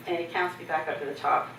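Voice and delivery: ordinary delivery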